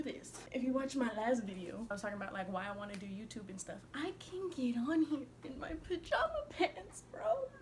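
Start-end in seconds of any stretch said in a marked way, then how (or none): 3.9-7.5 laughing. Real, not acted. Gushing about her pajama pants.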